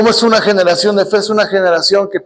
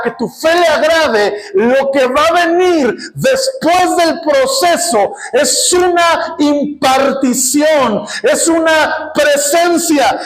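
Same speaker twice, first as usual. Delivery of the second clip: preaching at full force; 7 semitones higher than usual. Real, not acted. Pastor to congregation about God's glory, shouting fervently. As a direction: pour it out over the congregation. Preaching climax over music.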